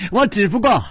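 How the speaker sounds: high voice just after a laugh; laughing reply. A narrator's reading. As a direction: say it merrily, fresh from laughing. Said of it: "银铃般的笑道". (笑着高声说)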